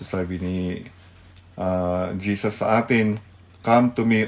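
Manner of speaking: usual speaking voice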